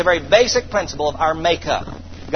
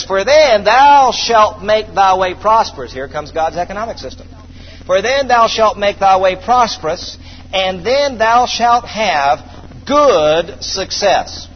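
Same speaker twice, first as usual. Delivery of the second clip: solemn scripture reading; 2 semitones higher, 4 dB louder, slower than usual. Acted.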